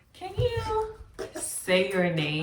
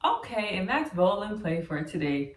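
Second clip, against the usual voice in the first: ordinary delivery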